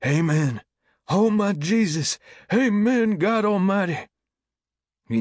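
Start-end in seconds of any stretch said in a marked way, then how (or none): 0.1-4.1 loud, very high ecstatic cries; congregation shouting. A narrator's reading. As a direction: shout praise far up in pitch. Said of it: "whispering or shouting".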